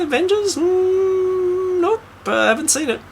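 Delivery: mock someone who's never heard of it; clueless partygoer. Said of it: "We're just like".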